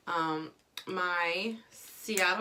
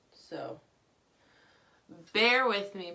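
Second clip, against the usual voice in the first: normal talking voice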